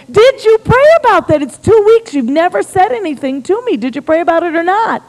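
high-pitched, exasperated grilling; agitated questioning. Retold or imitated. Husband grilling wife over steak dinner, agitated. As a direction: demand an answer, voice pushed up. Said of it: "he's a little agitated with me".

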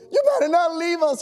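high, strained demand; desperate plea. Acted. Trapped fireman to Marine, desperate plea. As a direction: plead hard with your rescuer.

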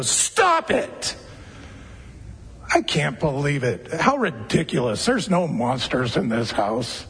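exasperated parent brushing off a nightmare; a hint of a fed-up parent; mock impatient parent. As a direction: snap it off, then grumble dismissively. Parent snapping at scared child.

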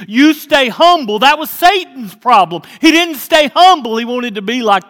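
loud, high preacher's warning; preaching at full force. Acted. Preacher warning the church against pride, loud and high. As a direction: build it up and hammer the humility home.

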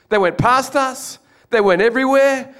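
high, rising excitement; excited retelling. Real, not acted. Preacher recalling bricks flying past, excited. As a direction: relive the near miss with awe.

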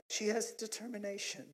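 soft, gentle imitation of a wife; uplifting wife. Wife rewording his goat remark, soft and uplifting. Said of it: "women always got the uplifting words".

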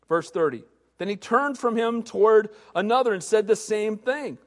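ordinary talk, nothing marked

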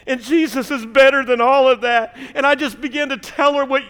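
high, loud, impassioned preaching; preaching at full force. Acted. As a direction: build the testimony to a fiery peak. Preaching climax of the story.

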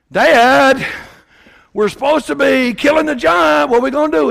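loud, high plea to his father; urgent shout. Acted. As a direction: shout at Dad to act now. Son urges frightened father.

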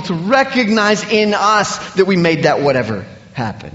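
pounding sermon climax on our vanity; fervent preaching. Acted. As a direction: preach it with building force. Preaching at full force.

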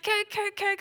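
put-on high-pitched toddler chant; eager toddler Stuart. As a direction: chant it like a little kid demanding cake. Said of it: "he's like".

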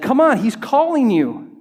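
high, loud, eager encouragement; urging call. Acted. Crowd cheering the blind man along, calling out eagerly. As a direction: call him over, cheering him on.

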